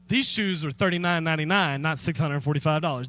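ordinary delivery

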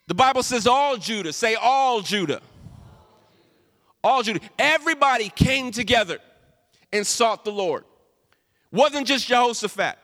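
loud, high preacher cadence; fervent pulpit preaching; preaching at full force. Real, not acted. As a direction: drive it home to the congregation.

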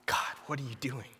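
a hushed, voiceless plea to God; breathy whispered question, no voice; whispered prayer. Retold or imitated. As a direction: whisper it like grief has taken the voice. Grieving friend's death, quoting his prayer.

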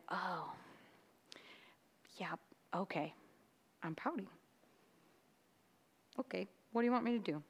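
soft, subdued climb-down; sheepish admission. Retold or imitated. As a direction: give in quietly, caught out. Retells sheepishly admitting to pouting.

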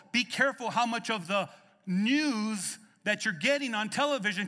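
high, loud preaching at full force; fervent preaching. Acted. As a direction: drive it home to the congregation.

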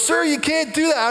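high-pitched imitation of a ride attendant; mimicked officious telling-off; scolding attendant. Voices ride attendant scolding him.

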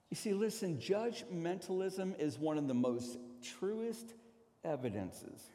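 ordinary talk

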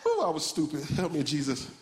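usual speaking voice

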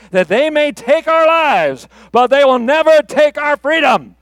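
defiant battle speech building to a loud, high finish; rally an army, swelling to a cry; William Wallace. Acted. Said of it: "William Wallace or Mel Gibson".

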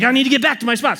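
high-pitched retold panic; freaked out. Hunter retelling his thought as the elk approaches, freaked out. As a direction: blurt it high, as if mildly panicking. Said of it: "So I'm freaked out".

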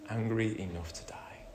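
whispered, sullen answer; bitter whisper. Retold or imitated. Preacher voicing Jonah answering God in a bitter whisper. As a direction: hiss it out bitterly.

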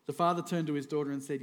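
ordinary talk, nothing marked

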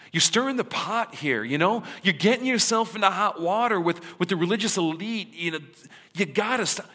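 worried critics loudly warning their friend; exasperated pleading. Retold or imitated. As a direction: press him hard to quit, half scolding. People urging Jesus to stop.

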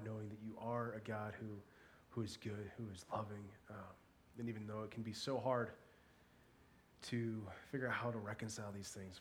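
soft, low, measured praying voice; solemn prayer. Real, not acted. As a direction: pray quietly, pausing between phrases. Leads a prayer for victims.

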